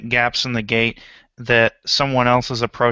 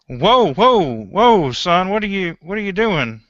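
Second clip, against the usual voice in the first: startled, higher and louder, hands up; alarmed whoa; 6 semitones higher, 4 dB louder than usual. Acted. Caretaker startled by a rifle, high alarmed whoa. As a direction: back off a gun pointed at him. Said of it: "puts up his hands like".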